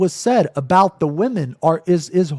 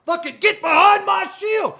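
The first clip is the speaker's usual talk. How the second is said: much higher, shouted hammed-up knight cry; mock heroic knight. Streamer mocking himself as a knight, mock heroic shout. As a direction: play a gallant knight shielding her. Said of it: "like I was a fucking knight in shining armor".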